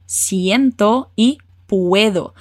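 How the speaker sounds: Siento e puedo stretched out very slowly; very slow model words. Shown as a demonstration. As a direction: drag each word out for the student. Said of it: "um detalhe especial". (professora dita as palavras bem devagar)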